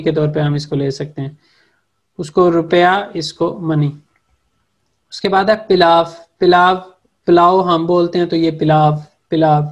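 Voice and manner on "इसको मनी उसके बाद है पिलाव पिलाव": ordinary talk, nothing marked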